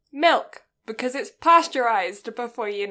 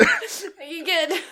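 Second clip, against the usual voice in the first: laughing; 6 semitones higher, much slower than usual. Real, not acted. Laughing partner, checking.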